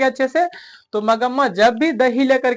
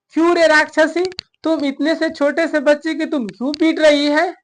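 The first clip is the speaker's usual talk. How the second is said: raised, higher-pitched angry scolding; scolding cry; 6 semitones higher than usual. Retold or imitated. Mother-in-law scolds daughter-in-law for beating the child, in a raised angry voice. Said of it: "ऐसे बोला".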